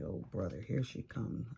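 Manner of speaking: bracing for a complaining neighbor, weary; weary groan. Retold or imitated. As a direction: dread the complainer coming. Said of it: "you're just like".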